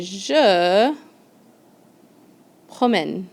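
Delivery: promène with an open è, pro-MEHN; accented e shown. Shown as a demonstration. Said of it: "Je promène with an accent over the E".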